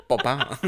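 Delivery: laughing two-beat bird noise; flamingo text tone. Retold or imitated. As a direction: copy the flamingo sound. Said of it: "The Xanthi flamingo text tone".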